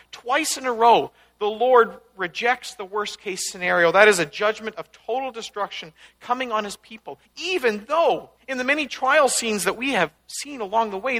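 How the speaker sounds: ordinary delivery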